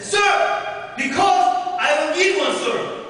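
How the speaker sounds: high, loud, slow answer to a superior; bold cadet reply. Retold or imitated. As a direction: stand straight and answer the officer. Cadet answers officer formally.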